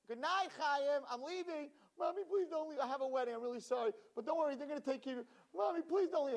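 put-on high childlike pleading; cling and beg her to stay; child begging mother. Child begging mother before wedding, high plea.

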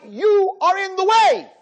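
slow, high and loud verdict; firm rebuke. Retold or imitated. Rabbi rebuking the teacher, slow and loud. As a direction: hammer each word home.

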